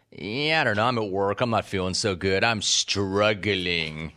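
droop through the whole complaint; mopey sad caller. Retold or imitated. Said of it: "How you doing, bro?".

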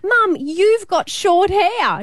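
high, slow exasperated protest; high indignant. Retold or imitated. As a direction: protest in disbelief, drawn out. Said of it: "And I was like".

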